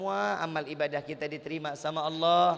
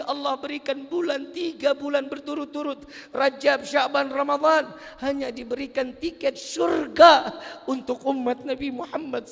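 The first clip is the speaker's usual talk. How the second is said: high, loud, impassioned preaching; fervent preaching; 8 semitones higher, 6 dB louder than usual. Acted. Preacher urging the congregation to repent, impassioned and loud. Preacher rises to full force.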